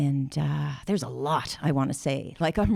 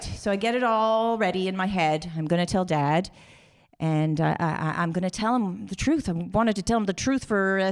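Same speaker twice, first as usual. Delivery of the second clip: usual speaking voice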